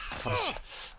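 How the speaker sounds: high, soft startled question; surprised. Real, not acted. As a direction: gasp it out in surprise. Surprise at crash.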